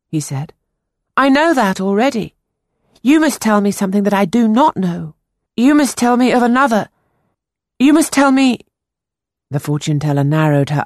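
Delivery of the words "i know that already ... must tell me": put-on higher, louder pleading boy; urgent boy. A narrator's reading. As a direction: insist desperately as the young boy. Boy Peter insists.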